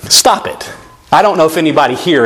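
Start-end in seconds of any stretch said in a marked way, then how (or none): 0.0-0.7 whispered through a laugh, softer; a laughing hush to the crowd; laughing rebuke. Real, not acted. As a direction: stop it, half laughing.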